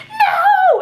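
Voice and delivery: very high, loud shout half laughing, half crying; screaming no. Retold or imitated. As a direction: wail it out as the shock hits. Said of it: "And she was screaming".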